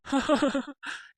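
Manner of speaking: a short scornful laugh; mocking laugh. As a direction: laugh him off as a fool. Inspector mocks the fake papers.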